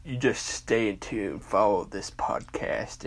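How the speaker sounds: ordinary talk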